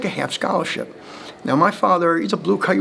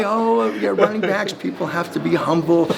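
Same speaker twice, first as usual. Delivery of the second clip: mock quote; 6 semitones higher than usual. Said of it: "they say".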